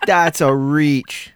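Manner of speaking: very slow, stretched That's a reach; drawn out. Real, not acted. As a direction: drag every word of That's a reach out slowly. Repeating the verdict on Siri's joke.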